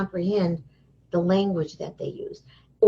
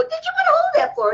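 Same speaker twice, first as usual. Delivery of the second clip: very high, fast playful question; teasing mock astonishment; much faster than usual. Woman teasing fallen boy, high mock astonishment. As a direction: rush it out high to tease a child.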